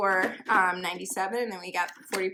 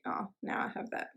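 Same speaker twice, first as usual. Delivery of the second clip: ordinary talk, nothing marked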